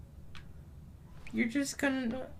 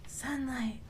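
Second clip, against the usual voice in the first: soft, subdued repeat; hushed, falling murmur; quiet echo; 12 dB quieter than usual. Pretend. Repeats it softly.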